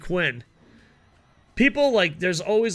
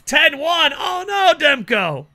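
excited shout; 11 semitones higher, 6 dB louder, slower than usual. Real, not acted. Reacting to game score.

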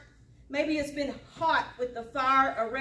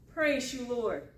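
ordinary talk

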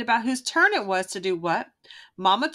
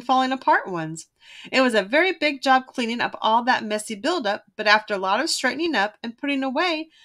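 usual speaking voice

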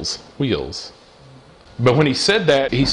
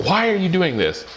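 raised, pitched-up retold cry; mock shout at heaven; the mad father's cry, understated; mad cry to God; 7 semitones higher than usual. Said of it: "I was mad".

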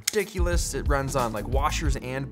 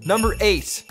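top ten countdown; 7 semitones higher, 6 dB louder than usual. Acted. Countdown announcement.

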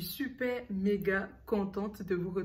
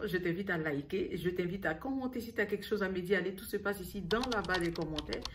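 ordinary talk

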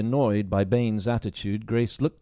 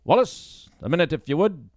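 a louder, higher call across the room; 7 semitones higher, 4 dB louder than usual. Acted. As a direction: summon him from the door. Baines calls out.

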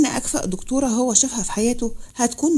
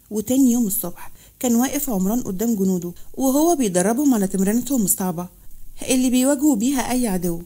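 ordinary delivery, nothing marked

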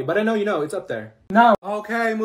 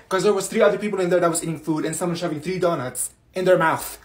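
normal talking voice

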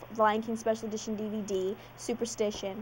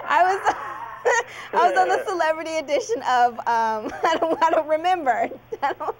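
laughing through the words; embarrassed laughing; 6 semitones higher, 4 dB louder than usual. Real, not acted. Embarrassed about game show question.

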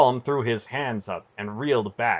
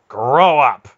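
loud, raised blunt command; blunt order; 4 semitones higher, 13 dB louder than usual. A narrator's reading. Aunt silencing Bear's complaint, blunt loud order. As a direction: shut him down with a forceful order. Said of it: "she ordered bluntly".

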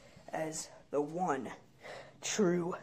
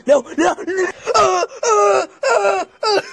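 much higher, loud panicked cries; panicked screaming; 13 semitones higher, 9 dB louder, slower than usual. Acted. Boy being absorbed by the god, panicked screaming. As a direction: wail as you're swallowed up.

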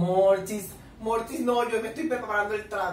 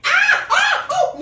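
high, loud shrieks of pure excitement; excited fan screams; 11 dB louder, much slower than usual. Real, not acted. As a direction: shriek like a fangirl at a kiss. Fan screams at kiss scene.

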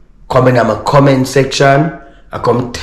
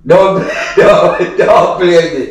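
laughing through the protest; 3 semitones higher, slower than usual. Real, not acted. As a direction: scold her but keep cracking up.

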